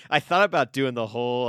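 ordinary talk, nothing marked

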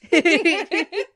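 high, loud burst of laughter. Real, not acted. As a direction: crack up laughing. Laughing at joke.

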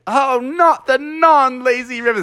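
high, wailing dismay; dismayed whine. Acted. Frog sent to the busy river, high dismayed whine. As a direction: moan it in a high voice.

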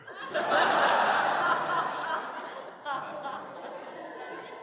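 high breathy burst of laughter; long laugh. Real, not acted. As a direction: let the laugh roll out. Speaker laughs at story.

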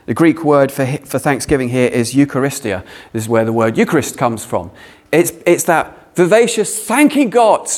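normal talking voice

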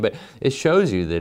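ordinary delivery

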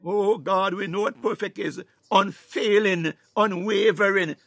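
ordinary delivery

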